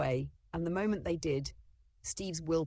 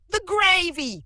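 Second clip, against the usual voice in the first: very high, loud panicked scream; 12 dB louder, slower than usual. Acted. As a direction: shriek about the spilled gravy. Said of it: "Mom screamed".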